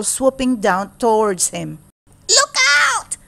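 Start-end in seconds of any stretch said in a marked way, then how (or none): 2.3-3.2 high, loud warning shout; urgent shout. Acted. Country Mouse warning his cousin of the owl, urgent shout. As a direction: yell it to save him from the owl. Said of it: "shouted Country Mouse".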